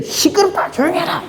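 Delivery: high, sharp hushing of a crowd; mock scolding. Crowd scolding the blind beggar to be quiet, mock hushing. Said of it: "막 야단을 쳐요".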